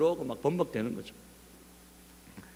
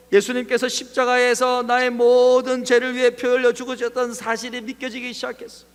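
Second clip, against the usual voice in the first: high, loud, sustained preaching pitch; impassioned testimony; 8 semitones higher, 8 dB louder than usual. Real, not acted. Telling the congregation how tears and repentance came, impassioned preaching. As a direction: pour out the conversion with raised voice.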